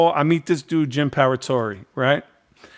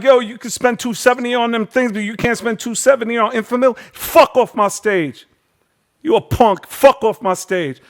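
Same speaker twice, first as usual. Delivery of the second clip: furious rant; 8 semitones higher than usual. Retold or imitated. Host throwing a deadbeat dad off his stage, furious. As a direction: kick him off the stage, furious.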